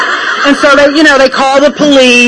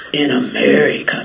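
deep cop voice; 9 semitones lower, 7 dB quieter than usual. Retold or imitated. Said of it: "That's how his voice sounded".

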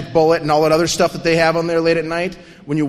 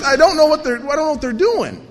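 ordinary talk, nothing marked

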